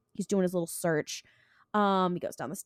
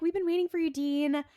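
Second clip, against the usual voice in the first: high-pitched, trying-too-hard come-on; mock seductresses; 9 semitones higher than usual. Two women luring Dean, mock seductive. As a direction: imitate temptresses in a high voice. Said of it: "they're trying so hard".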